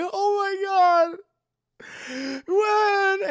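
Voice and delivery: high, loud slow sobbing. Retold or imitated. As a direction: wail her name through tears. Peter sees Gwen is dead.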